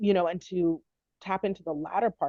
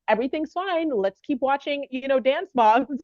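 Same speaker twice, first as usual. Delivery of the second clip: high, bright put-on cheer, louder; forced cheerful; 8 semitones higher, 7 dB louder than usual. Mom faking calm for her daughter after the hallway, put-on cheer. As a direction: cover the worry with breezy cheer. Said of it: "stern with doctors ... came back in".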